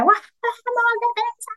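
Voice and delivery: high, slow, drawn-out cheer from the stands; cheering mom yell. Retold or imitated. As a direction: cheer your daughter's team on, stretched out and high. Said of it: "yo soy la mamá que grita".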